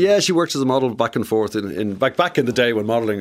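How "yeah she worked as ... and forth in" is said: ordinary talk, nothing marked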